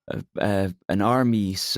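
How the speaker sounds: ordinary talk, nothing marked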